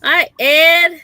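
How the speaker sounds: high, loud bright hello to a viewer; cheery greeting. Real, not acted. Greeting chat viewer by name.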